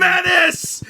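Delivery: shouting. Real, not acted. Chanting the name loudly.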